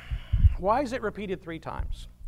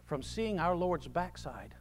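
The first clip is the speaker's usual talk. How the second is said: ordinary delivery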